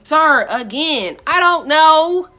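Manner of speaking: loud, drawn-out mock wail; hammed-up tearful exasperation; crying protest. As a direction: wail it slowly and high. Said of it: "I'm crying to my sister".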